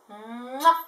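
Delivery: loud smacking kiss; blown kiss. Real, not acted. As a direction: blow a big kiss. Aunt blowing kiss to child.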